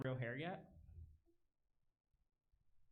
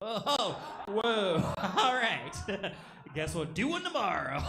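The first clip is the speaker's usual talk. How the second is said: startled laughing whoa; 9 semitones higher, 16 dB louder, slower than usual. Retold or imitated. Shocked reply to grandfather.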